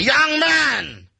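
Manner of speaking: high, drawn-out holler; shouted call. Retold or imitated. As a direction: bellow it across the bar, stretching the words. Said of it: "he actually shouted at us".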